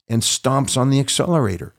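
normal talking voice

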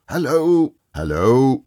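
loud mock-gruff hail; feigned growl. A narrator's reading. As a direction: bark it like a boss feigning crossness. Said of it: "growled Scrooge in his accustomed voice, as near as he could feign it".

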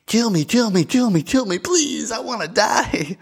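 high whiny pleading voice; mock begging fly. The fly buzzing in his face begging to be killed, high whiny plea. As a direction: beg like a tiny bug wanting death.

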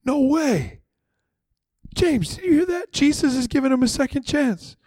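a stunned bystander nudging a friend; amazed gossiping disciple. Acted. Disciple tells James of Peter's second chance, amazed and high.

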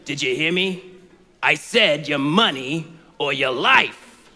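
radio villain pressing the threat; impatient robber voice. Retold or imitated. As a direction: lean on it like a stickup man losing patience. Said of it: "the bad guy's like".

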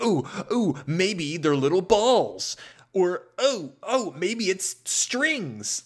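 high, eager, slowed idea pitch; excited brainstorm. Pretend. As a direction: gush like a giddy inventor. Candy makers brainstorming, voiced.

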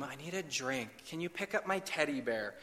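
small, soft imitation of a child's request; child's bedtime plea. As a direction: softly mimic a little boy stalling at bedtime. Child calling from bedroom.

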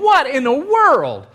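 loud, high, mock outrage; worked-up exasperation. Preacher mimics the whole crowd grumbling at Jesus, exasperated. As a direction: build to an incredulous cry.

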